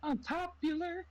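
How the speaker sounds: very high, slow falsetto. Acted. Singing Popular as a joke, very high falsetto. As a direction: squeak it way up like a Broadway ingenue.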